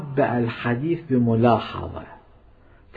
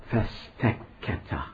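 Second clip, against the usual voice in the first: whispered; 3 semitones lower, 9 dB quieter, slower than usual. A narrator's reading. Ears went deaf, hushed.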